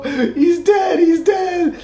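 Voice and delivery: high, wailing fake sobs; mock bawling. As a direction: exaggerate a distraught, high-pitched wail. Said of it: "she's fucking bawling".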